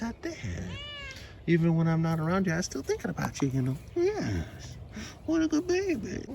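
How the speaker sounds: dote on the cat in a high sing-song. Real, not acted. Cooing at the cat at length.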